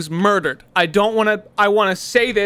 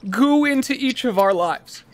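hammed-up eulogy climbing higher toward fake grief; mock funeral eulogy; 7 semitones higher than usual. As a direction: play a grieving parent at a funeral, overdoing it.

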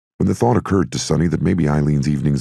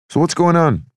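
put-on higher, louder young man voice; pitched-up eager young man; high character voice; 5 dB louder than usual. Cork voiced by narrator.

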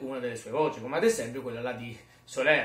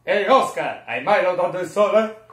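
loud, higher put-on character voice; Soler; 5 semitones higher, 11 dB louder than usual. Imitating Soler calling to Oscar, loud character voice. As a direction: boom it out in character. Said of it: "Poi c'è sta la voce di Soler".

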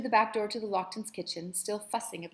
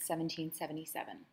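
usual speaking voice

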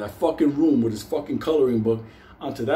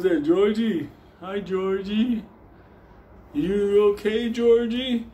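put-on higher, slow sing-song baby talk; 7 semitones higher, slower than usual. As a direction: coo it like to a toddler.

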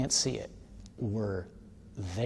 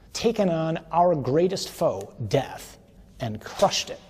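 higher, louder and slow, building triumph; rising fervent sermon, louder and higher; preaching at full force; 5 semitones higher, 6 dB louder, slower than usual. Real, not acted. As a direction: proclaim it like a victory.

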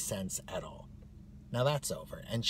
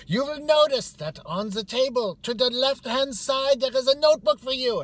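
high, loud barked instructions; strict orientation leader; 11 dB louder than usual. Retold or imitated. Woman at the front instructs new hires, barking high. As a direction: bark it out like a caricatured martinet.

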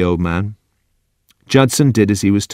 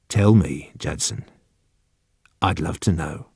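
very soft, low gentle plea; coaxing; 4 semitones lower, 7 dB quieter than usual. Acted. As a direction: coax him softly, almost confidentially. Old man coaxes confession.

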